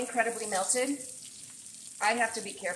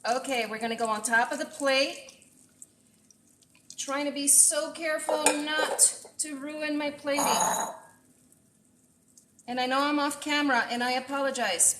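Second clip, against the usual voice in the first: usual speaking voice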